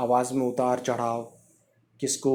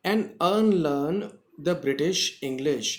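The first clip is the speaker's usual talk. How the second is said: slow dictation; much slower than usual. Shown as a demonstration. Teacher reading the next line slowly to the class. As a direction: draw out each word for learners. Teacher reads English text aloud.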